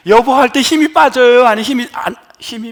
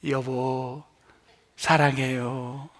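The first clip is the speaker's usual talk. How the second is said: soft, slow, loving 여보 사랑해요; tender 여보 사랑해요; 10 semitones lower, 12 dB quieter, much slower than usual. Shown as a demonstration. Pastor showing how to say 여보 사랑해요 to a spouse, soft and tender. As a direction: coo it gently to your spouse. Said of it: "입에 힘이 안 들어가는 것만 하세요".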